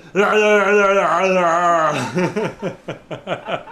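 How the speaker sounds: drawn-out laughing cheer; exercise laugh. Pretend. Leader finishing a laughter yoga chant, exercise laugh. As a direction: laugh through a long cheer, ending in chuckles.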